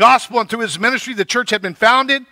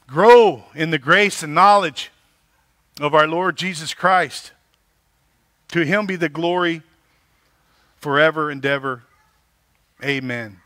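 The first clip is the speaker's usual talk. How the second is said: scripture reading; 7 semitones lower than usual. Acted. Pastor reads Second Peter aloud.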